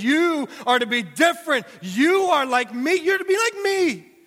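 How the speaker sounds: Jesus addressing his followers, loud and soaring; Jesus commanding. Acted. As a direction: lift it up high like a solemn command. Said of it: "Jesus looks at his disciples and says".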